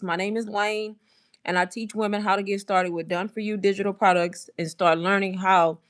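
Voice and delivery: usual speaking voice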